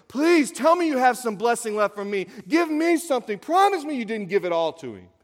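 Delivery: loud, high-pitched pleading; desperate begging. Acted. Esau begging his father for a blessing, desperate. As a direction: beg urgently, voice pushed up high. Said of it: "then he begs his father".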